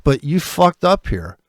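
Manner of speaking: usual speaking voice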